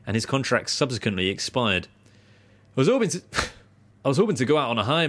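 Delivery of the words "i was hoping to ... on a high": put-on higher-pitched stab at a Yorkshire accent; attempted Yorkshire accent. Podcast host reading a retiring cricketer's quote, attempted Yorkshire accent. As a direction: have a shaky go at Yorkshire. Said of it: "but this is a Yorkshire accent".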